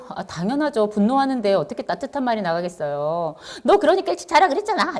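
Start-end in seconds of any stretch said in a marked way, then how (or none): 3.6-5.0 high-pitched mock scolding; acted parental anger. Parent scolding a child over bedtime, angry and high. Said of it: "이러면서 막 화를 내시잖아요".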